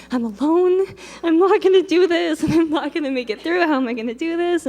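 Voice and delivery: high, loud panicky self-quote; blurting out her fear on the plane; frightened girl losing it alone; panicked. Retold or imitated.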